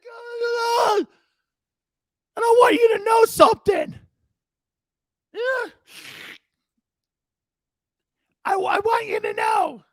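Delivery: squealing, tearful mock locker-room rally cry; coach in tears. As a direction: imitate a coach crying and shouting to his team. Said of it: "Here's Marty in tears".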